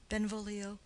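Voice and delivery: ordinary talk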